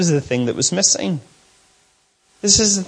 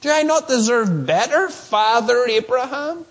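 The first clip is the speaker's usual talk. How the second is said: entitled complaint; 6 semitones higher than usual. Retold or imitated. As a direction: whine it like someone owed better. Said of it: "He plays the race card".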